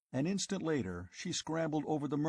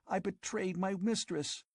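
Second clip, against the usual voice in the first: higher, feverish visions of riches; girl tempting her lover with an empire; passionate scheming girl; 8 semitones higher than usual.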